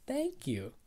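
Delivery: very high, awkward thank you; blank, awkward thanks. Retold or imitated. Complimented speaker whose mind goes blank, squeaky awkward thanks. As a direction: squeak your thanks with nothing to follow it. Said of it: "my mind just goes blank".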